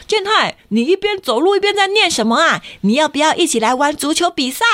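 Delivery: put-on high, friendly older boy; big brother voice. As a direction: invite him like an eager big brother. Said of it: "哥哥就说".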